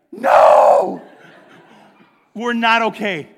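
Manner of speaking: hammed-up, explosive cry of protest; desperate shout. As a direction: blurt out a huge comic yell, then stay loud and high. Daughter's desperate answer to hikers, acted.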